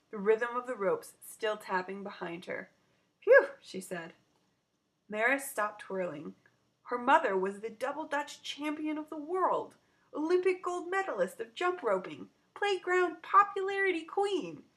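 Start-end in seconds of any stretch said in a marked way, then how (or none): 3.2-3.5 loud, high puff of relief; breathless phew. Acted. Out of breath after jumping. 6.8-14.6 climbing higher and louder; rising awe. A narrator's reading. Narrator building awe.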